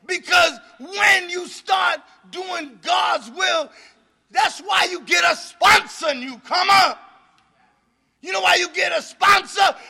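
loud preaching to the room; fiery recovery-meeting preacher. Acted. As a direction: thunder it out at the newcomers.

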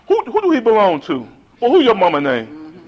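lightly voiced grandma asking whose child; grandma questioning. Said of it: "she talked just like that".

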